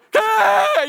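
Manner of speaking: very high, loud, drawn-out scream; freaking out. Acted. Dad screaming for lost son on the beach, freaking out. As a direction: scream his name in mounting panic. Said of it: "you start freaking out".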